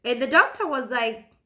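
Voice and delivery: usual speaking voice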